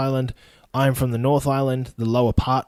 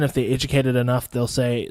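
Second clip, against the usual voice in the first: normal talking voice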